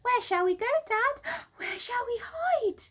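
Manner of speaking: put-on children tugging at Dad; excited little monkeys. A narrator's reading.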